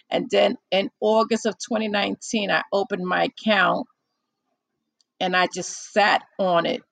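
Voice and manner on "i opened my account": ordinary talk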